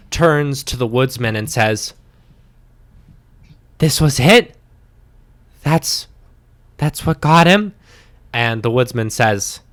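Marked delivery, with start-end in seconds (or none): 3.8-7.7 louder, a bit higher stunned question; disbelieving gasp at the corpse; shocked Elizabeth. Acted.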